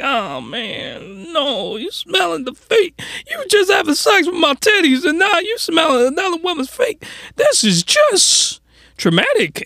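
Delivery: hammed-up heartbroken girlfriend; whiny upset girlfriend. Voices the crying girlfriend watching.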